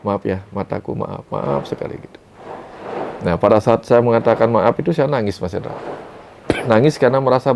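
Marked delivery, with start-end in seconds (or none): 0.0-2.0 whispered, tender apology to his own aching eyes; hushed and remorseful, almost in tears. Retold or imitated.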